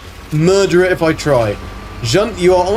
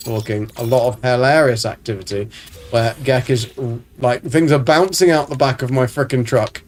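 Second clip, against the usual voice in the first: normal talking voice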